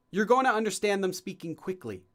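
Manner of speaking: normal talking voice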